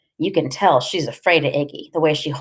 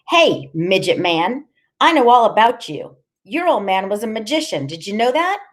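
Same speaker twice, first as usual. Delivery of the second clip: raised, needling character voice; taunting Loretta; 5 semitones higher than usual. Acted. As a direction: tease him with what you know.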